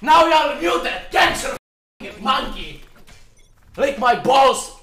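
furious high-pitched screaming insults; raging gamer. Real, not acted. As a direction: yell it in blind rage. Raging at a teammate in game.

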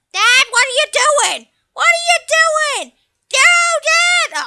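put-on a boy horrified at what his dad is doing; alarmed child voice. Son shrieking at his dad, a high, panicked kid voice.

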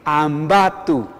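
slow Ambato, each syllable separate; teacher sounding out Ambato; pronunciation demo. Shown as a demonstration. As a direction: model Ambato carefully, ending in 'tu'. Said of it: "así".

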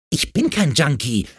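put-on girl's indignant outcry. A narrator's reading. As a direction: protest sharply, stung by the accusation. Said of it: "rief Effie empört".

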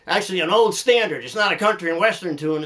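usual speaking voice

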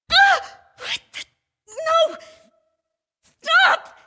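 high, loud screams for help; terrified screams. Acted. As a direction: scream in terror. Character attacked, screaming for help.

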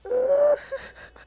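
high, loud shrieking laugh. Real, not acted. Reading friends' comments, a loud shrieking laugh. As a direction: burst into a squealing laugh.

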